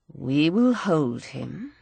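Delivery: low, slow, soft grim promise; a naval officer's quiet grim resolve; a slow low vow from the officer. Acted. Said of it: "he says grimly".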